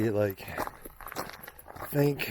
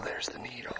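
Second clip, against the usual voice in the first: whisper; 3 semitones higher, 5 dB quieter than usual. Real, not acted. Reaching pass, first view.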